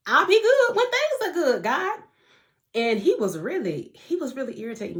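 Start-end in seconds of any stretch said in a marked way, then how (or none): 0.1-2.0 much higher and louder, a pleading whine; mock demand to God. To God, wanting good times first, high pleading whine. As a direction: toss it up at God like a spoiled demand.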